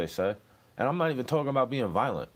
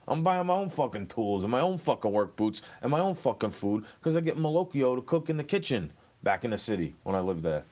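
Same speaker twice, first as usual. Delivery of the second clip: ordinary delivery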